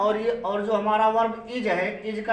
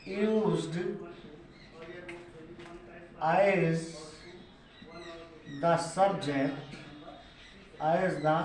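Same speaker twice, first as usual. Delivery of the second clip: very slow and soft, long gaps between words; word-by-word dictation; 3 semitones lower, 6 dB quieter, much slower than usual. Real, not acted. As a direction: dictate it haltingly while writing it out. Writing out the definition while saying it.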